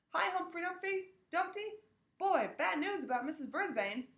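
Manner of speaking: put-on high, boisterous boy's greeting; hearty schoolboy hello; loud kid voice. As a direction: call out cheerfully. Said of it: "A J's loud voice".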